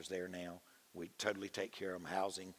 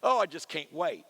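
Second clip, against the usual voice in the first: high, loud, gushing retelling of an excited pastor; 9 semitones higher, 7 dB louder than usual. Retold or imitated. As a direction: bubble over with joy as you quote him. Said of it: "and he was so excited".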